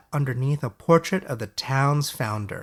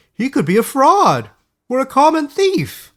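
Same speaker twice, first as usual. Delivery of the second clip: high, loud, worried councilman voice; a flustered elder pleading for help, pitched way up; high-pitched councilman, loud and anxious; fussy town elder; 14 semitones higher, 9 dB louder than usual. A narrator's reading. Narrator voices Oliver.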